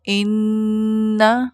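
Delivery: "انا" with the doubled نون hummed long through the nose; held nasal ghunna. Shown as a demonstration. Teacher showing students how to say انا, long nasal hum. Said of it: "تھوڑی دیر اس کو ناک سے ادا کرنا ہے".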